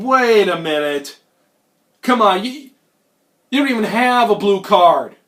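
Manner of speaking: exasperated protest. Retold or imitated. Visitor challenging the hustler's cheating, exasperated. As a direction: protest in comic disbelief.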